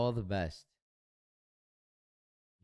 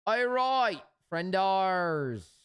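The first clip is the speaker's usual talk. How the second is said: loud greeting; 8 semitones higher, 6 dB louder, much slower than usual. Real, not acted.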